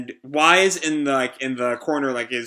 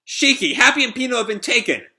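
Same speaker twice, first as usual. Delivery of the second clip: high, urgent call; alarmed report; 9 semitones higher than usual. Acted. Rebecca telling Shiki of the kidnapping, alarmed high call. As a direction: deliver bad news in a rising panic.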